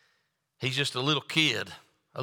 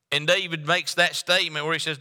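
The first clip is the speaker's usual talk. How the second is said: ordinary talk, nothing marked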